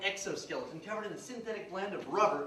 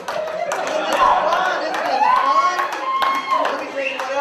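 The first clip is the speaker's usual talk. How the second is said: much higher, louder, stretched-out glee; hammed-up delight; 14 dB louder, slower than usual. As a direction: oversell the fun. Salesman hams up his delight.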